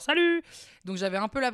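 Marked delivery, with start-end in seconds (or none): 0.0-0.4 sudden high, loud cheery hello; bright mock greeting. Showing how she greets unwarned people on camera, high loud cheery. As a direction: pop up chirpy in front of the camera. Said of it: "tu fais genre".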